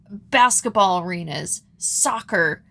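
normal talking voice

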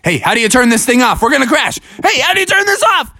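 storybook bear in rising panic; panicking Bubby. A narrator's reading. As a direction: demand help before the crash. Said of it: "Bubby was like".

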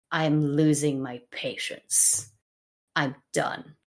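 low, soft, weary resignation; tired tragedy. Acted. Henry runs out of patience after losing Gloucester, tired. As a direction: sound worn out, slowly running out of patience. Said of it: "my impression of a tired tragedy".

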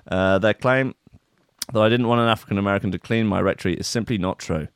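usual speaking voice

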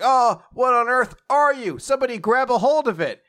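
high, loud mock horror; alarmed outcry. Onlookers confront the cat monster, alarmed outcry. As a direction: cry out in theatrical alarm. Characters react to monster attack.

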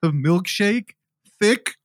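slow, imitated tearful order; mock sobbing. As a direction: draw it out as a pretend sob. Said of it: "he's, like, sobbing".

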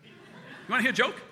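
high, loud repeated offer; insistent doctor. Retold or imitated. Bored Doc presses patient about a joke, insistent.

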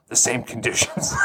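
near-whispered dramatic read; Jocko Willink. Acted. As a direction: let it sink to a hushed, portentous whisper. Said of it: "You've gotta read this like Jocko Willink".

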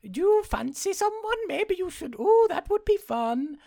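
very high, put-on prying voice; pry like a hammed-up creepy busybody; creepy neighbor. Neighbor prying into her love life, creepy voice. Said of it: "She meets her creepy neighbor again".

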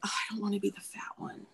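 put-on soft, small, hurried voice of an insecure girl; little girl insecurity. Her inner insecure little girl beside a beautiful teammate, soft and small. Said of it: "revert back to that, like, little girl".

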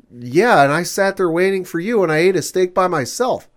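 loud, high, fed-up scolding; retold angry shout. Angry date yelling at the man over the phone, loud. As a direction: let him have it.

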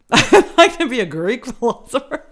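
high, loud, laughing through the words. Real, not acted. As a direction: crack up at your own answer. Laughs at her own retold answer.

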